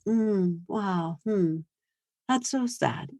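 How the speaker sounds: soft, detached non-replies; quiet disengaged murmurs to an angry kid; brain dead. Shown as a demonstration. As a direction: murmur as if unbothered and tuned out. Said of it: "I am just gonna go brain dead".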